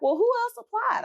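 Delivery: shoots up high in disbelief; incredulous question. Retold or imitated. She asks while scrolling a list without her name, disbelieving and high.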